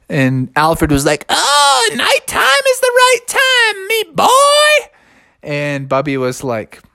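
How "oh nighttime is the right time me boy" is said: very high, gleeful owl voice; shrieky falsetto bird character; zany owl. A narrator's reading. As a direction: crow it like a wild old owl. Owl answers the bear.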